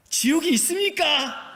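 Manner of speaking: high, loud booming auntie voice; booming Jeolla lady. Retold or imitated. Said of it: "쩌렁쩌렁하게". (높고 큰 쩌렁쩌렁한 아주머니 목소리)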